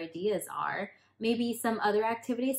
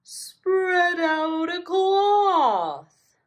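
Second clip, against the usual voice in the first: very slow, high sing-song voice; slow sloth; 12 semitones higher, 10 dB louder, much slower than usual. Acted. Sloth directing the party, very slow sung voice. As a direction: stretch every word like a lazy sloth. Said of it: "directed the sloth".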